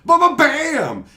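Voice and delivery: loud high ba-ba-bam; explosive spell noise; magic blast sound. Retold or imitated. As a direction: punch out a cartoon blast.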